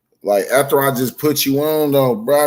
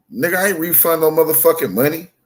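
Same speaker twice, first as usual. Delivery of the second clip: retold outraged comeback, pitched up; higher, incredulous mock protest; indignant retort; 6 semitones higher, faster than usual. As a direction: fire it back like you can't believe the accusation. Retelling outrage at cousin's accusation.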